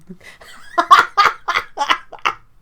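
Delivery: high burst of loud laughter. Real, not acted. Laughing at a name.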